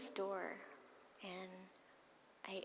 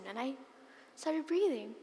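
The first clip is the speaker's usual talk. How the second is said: ordinary talk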